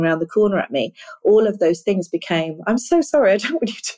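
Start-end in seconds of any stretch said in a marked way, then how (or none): 2.7-3.9 real laughter breaking into an apology; laughing apology. Real, not acted. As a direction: apologise through a real laugh, higher pitch. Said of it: "I'm laughing while you're crying".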